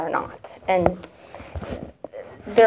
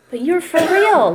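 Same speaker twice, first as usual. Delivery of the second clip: amazed exclaim; 9 semitones higher, 4 dB louder than usual. Retold or imitated. Marvelling at her mother-in-law's lack of bitterness, excited. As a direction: jump up high and loud in amazement.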